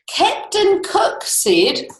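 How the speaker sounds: slow. Acted. Story rhyme read aloud.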